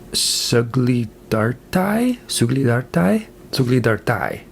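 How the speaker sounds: Suglidartai sounded out slowly, questioning, then settled with a falling end; tentative foreign pronunciation. Shown as a demonstration. Reviewer attempting the original Russian title, tentative pronunciation. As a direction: try Suglidartai carefully, rising twice, then land it.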